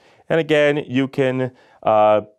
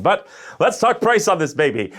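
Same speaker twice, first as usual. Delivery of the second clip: higher, louder sales pitch; used car salesman; 10 semitones higher, 4 dB louder than usual. Pretend. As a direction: pump it up like a car lot ad. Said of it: "I just sounded like a used car salesman".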